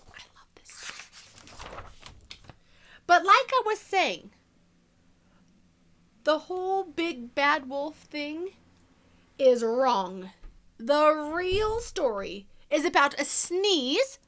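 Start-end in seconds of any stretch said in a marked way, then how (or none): 3.1-14.2 sly wolf narrator, hushed then punchy; storybook reading. A narrator's reading. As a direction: tell it to children as the wolf, confiding and playful. Continues the wolf's story.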